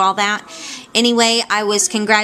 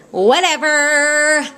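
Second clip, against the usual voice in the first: dismissive whatever; 6 semitones higher, 4 dB louder, much slower than usual. Acted. Dismissive after losing aide.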